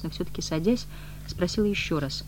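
plain reading voice

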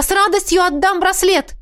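high, glad offer; eager exclamation. A narrator's reading. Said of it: "воскликнула я".

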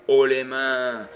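loud, slow mocking cry; mock shout. A narrator's reading. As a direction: throw haut les mains back at him. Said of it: "C'est moi qui pourrais te crier". (cri moqueur, lent et fort)

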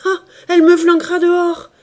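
high, very loud furious cry; furious husband yelling at his defiant wife; furious shout. A narrator's reading. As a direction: explode in rage at her dare. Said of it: "cria le mari furieux".

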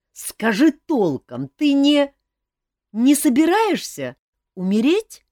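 high, loud desperate plea; pleading. A narrator's reading. Elmar begging his king cousin to explain, frightened plea. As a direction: beg for the truth. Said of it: "взмолился принц-бастард".

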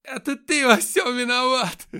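very high, very loud, slow screaming; hysterical screaming. Acted. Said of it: "истерично закричала женщина".

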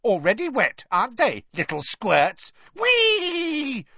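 high, gleeful taunting poltergeist voice; cackling poltergeist. Acted. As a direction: jeer like a giddy mischief-maker. Peeves taunting students.

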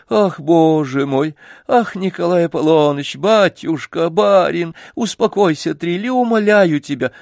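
high, frantic begging; pleading servant. A narrator's reading. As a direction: plead anxiously to calm the child.

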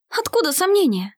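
high-pitched, mildly anxious question; worried question. A narrator's reading. Girl questioning her ally's doubts, lightly worried. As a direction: ask with a touch of worry. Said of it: "с беспокойством обернулась к нему".

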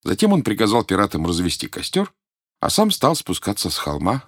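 usual speaking voice

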